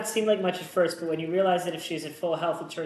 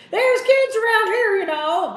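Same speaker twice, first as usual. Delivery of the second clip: high, loud, slowed yell at a speeding driver; 11 dB louder, slower than usual. Acted. Store owner yelling at a speeding driver, loud and high. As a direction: scold him at the top of your lungs. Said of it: "Vic yelled".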